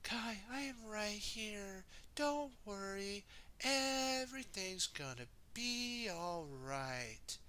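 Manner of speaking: high, slow, soft sing-out. Acted. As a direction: soothe slowly in a high, soft voice. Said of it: "in her soft voice like this".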